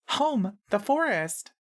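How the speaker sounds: put-on high, slow girlish voice; high-pitched girl. A narrator's reading. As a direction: draw it out in a high girl's pitch. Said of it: "and high-pitched".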